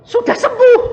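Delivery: very high, loud triumphant shout, falling; proclaimed healing. Retold or imitated. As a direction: call it out as a proclamation. (menyatakan kesembuhan dengan seruan keras yang turun)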